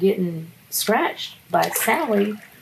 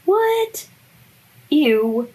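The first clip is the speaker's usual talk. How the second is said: high shocked what then ew; grossed-out surprise; 11 semitones higher, 4 dB louder than usual. Real, not acted. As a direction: squeal in disgust.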